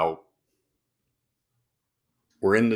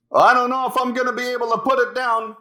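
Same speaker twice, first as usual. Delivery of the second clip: high, raised voice over rotor noise; pilot shouting over chopper; 10 semitones higher, 4 dB louder, faster than usual. Retold or imitated. Pilot to Hasselhoff in the chopper, shouting high. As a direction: call out over the engine. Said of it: "Why are you talking so loud?".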